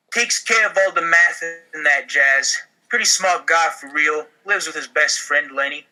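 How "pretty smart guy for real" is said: normal talking voice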